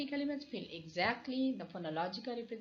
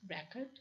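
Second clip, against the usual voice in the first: record stressed on the starting syllable, RE-cord; front-stressed record, weak second syllable; noun stress on record. Shown as a demonstration. Lecturer showing how the noun is pronounced, record stressed on the first syllable. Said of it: "When it will be a noun".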